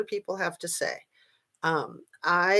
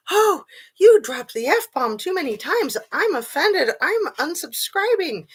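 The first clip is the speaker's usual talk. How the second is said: high, prissy mock complaint; sneering imitation of an offended viewer; mocking critic; 9 semitones higher, 4 dB louder than usual. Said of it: "my little critics out there going".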